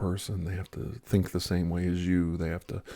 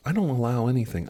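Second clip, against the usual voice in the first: ordinary delivery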